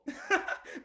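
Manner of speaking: a sudden high airy burst of laughter; high breathy laugh. Real, not acted. Recalling panicked wake-up to viewers, high breathy laugh. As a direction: laugh out at the memory. Laughing at her own story.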